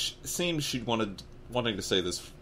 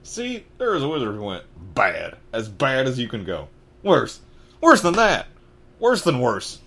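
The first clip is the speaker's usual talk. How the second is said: put-on southern-accented build from steady to loud; Hagrid, dreading; 5 semitones higher, 8 dB louder than usual. Giant describing an evil wizard, mounting dread. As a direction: climb in pitch and force with each worse.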